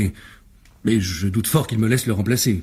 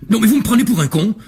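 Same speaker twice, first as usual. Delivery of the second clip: indignant outburst; 10 semitones higher, 4 dB louder than usual. Acted. Actor refuses the plot.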